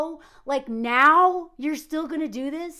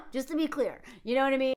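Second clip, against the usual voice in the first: ordinary talk, nothing marked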